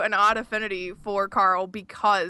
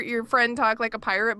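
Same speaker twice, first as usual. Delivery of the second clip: ordinary talk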